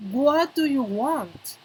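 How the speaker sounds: slow, drawn-out teacher's example; deliberate, slow demonstration with falling end; slow model pronunciation. Shown as a demonstration. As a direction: stretch each word for learners to hear. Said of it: "La pronunciación es importante".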